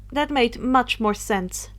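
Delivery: ordinary delivery, nothing marked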